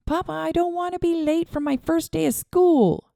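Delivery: put-on a kid desperate not to be late; eager little boy. A narrator's reading. Boy pleading with his papa before school, eager.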